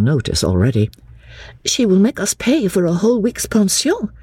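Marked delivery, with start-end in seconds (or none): 1.6-4.1 put-on high, earnest young woman's voice; a girl standing up to her cousin, higher; young Lucy. Lucy answering her cousin Charlotte, earnest and high.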